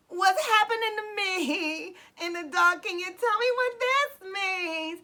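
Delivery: retold plea breaking into tears; tearful plea. Retold desperate prayer to God.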